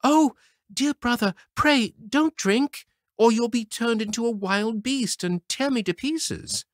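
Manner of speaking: put-on high, loud pleading girl; frightened sister cries out to her brother; crying out. A narrator's reading. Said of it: "So she cried out".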